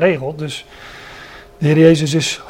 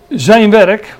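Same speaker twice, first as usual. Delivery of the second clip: ZIJN punched higher and louder; emphatic "zijn", pitched up and loud; stress on ZIJN; 4 semitones higher, 7 dB louder than usual. Real, not acted. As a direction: hit the word "zijn" hard. Preacher hammers home his key point.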